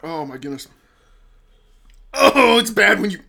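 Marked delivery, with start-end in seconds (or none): 2.1-3.2 loud pained outburst; pained cry. Real, not acted. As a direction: cry out from the burn. Sudden pain outburst.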